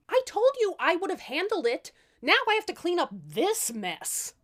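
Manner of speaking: high, loud exasperated scold; annoyed scolding. Acted. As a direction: chew them out for not listening. Tatterhood scolding family.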